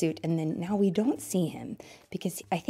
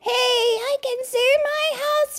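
very high, loud squeal stretched out; sustained falsetto, slow and showy; triumphant treetop turtle; 7 dB louder, slower than usual. Acted. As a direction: call down from way up in a squeaky cartoon voice.

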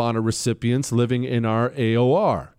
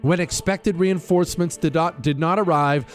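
formal citation read over music; crisp military citation; 4 semitones higher than usual. Acted. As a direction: read it like an official military commendation. Continues reading the citation.

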